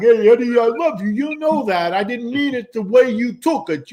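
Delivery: loud, high, hammed-up tearful apology; imitated crying New Yorker making up; mock crying. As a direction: wail your love to an old friend, overdone. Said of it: "and they're crying".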